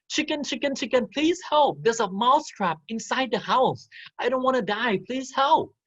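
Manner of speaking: high, pleading little mouse voice; begging mouse. Acted. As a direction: beg for help like a tiny frightened mouse. Mouse begs the chicken.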